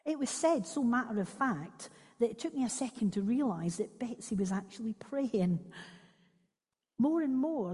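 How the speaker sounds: expressive read-aloud of a memoir; story reading. Acted. Speaker reads Corrie ten Boom's memoir to listeners, expressive read-aloud. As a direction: read the extract aloud, voicing the sisters. Reads book extract to congregation.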